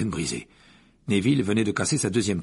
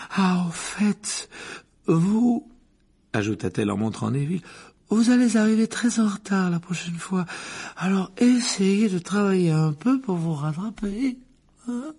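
higher, louder, prophetic aside; Trelawney voice; 9 semitones higher, 3 dB louder than usual. Acted. The divination teacher points at Neville, airy and prophetic. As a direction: warn him airily of his fate.

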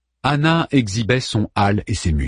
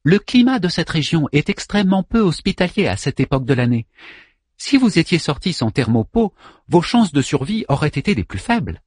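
polite servant robot; 7 semitones higher than usual. Acted. Servant machine voice.

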